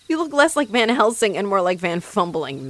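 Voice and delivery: ordinary delivery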